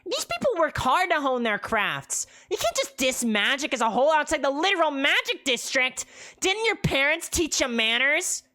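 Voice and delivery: high, loud scolding squawk; harpy telling off the preacher; angry harpy. Acted. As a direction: scold him like a cross bird. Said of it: "with an angry look".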